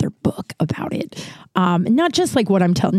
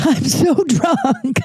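laughing; 6 semitones higher, much slower than usual. Pretend. Joking about being drunk.

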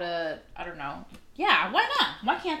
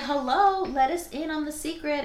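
higher, pleading tease; teasing plea; 8 semitones higher than usual. Retold or imitated. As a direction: beg to be let in, teasingly. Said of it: "And we were like".

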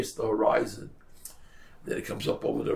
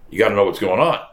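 ordinary talk

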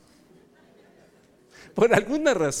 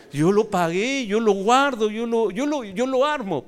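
high, insistent mock-outraged list; indignant protest; 5 semitones higher, 4 dB louder than usual. Pastor defending his tree to the brother, playfully indignant. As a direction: pile up each claim, pitch climbing.

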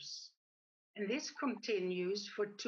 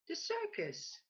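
landlord's reply pitched much higher with a falling end; bright, higher landlord voice confirming; cheerful confirmation; 8 semitones higher than usual. A narrator's reading.

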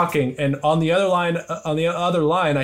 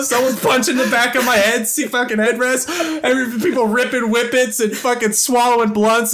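high, raised voice re-enacting total chaos; frenzied excitement; 9 semitones higher, 4 dB louder than usual. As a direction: yell it over a carful of screaming friends. Acts out the car erupting.

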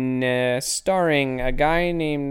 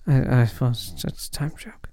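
whispered aside; 3 semitones lower than usual. Real, not acted. Muttered aside under breath.

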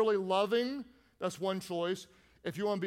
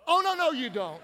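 high, loud sassy refusal; sassy attitude; 6 semitones higher, 5 dB louder than usual. Shown as a demonstration. Congregation practicing a sassy refusal on count of three. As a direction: snap it with attitude. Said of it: "Practice with me".

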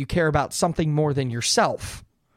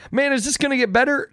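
high, quicker plea for relief; exasperated question; 10 semitones higher, faster than usual. Acted. Person in their thirties asking about aches, mock exasperation. Voicing a complaint about ageing.

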